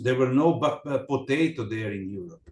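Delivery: normal talking voice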